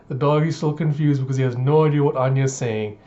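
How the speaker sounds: ordinary talk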